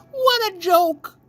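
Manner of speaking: very high, squeaky mocking birds; high chirpy bird voices scoffing; jeering robins. A narrator's reading. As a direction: taunt like cheeky little birds.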